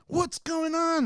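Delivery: high-pitched, panicky mock cry; re-enacted frantic question; imitated alarmed mom. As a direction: mimic a mother running in alarmed. Alarmed mom comes running in.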